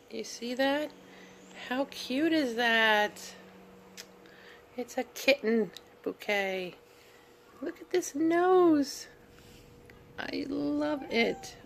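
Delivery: high, slow, doting cute voice; cooing over flowers. Real, not acted. Showing viewers a kitten bouquet, cooing high and slow.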